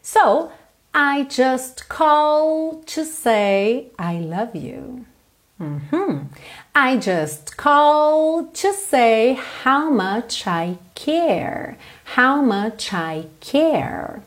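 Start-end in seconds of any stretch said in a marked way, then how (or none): 0.9-5.1 slow, drawn-out learner-paced English; slow lyric model. Shown as a demonstration. English teacher models a song line for learners, slow demonstration. 6.7-14.2 very slow English with drawn-out vowels; slow lyric model. Shown as a demonstration. Teacher shows learners the song line, slow demonstration.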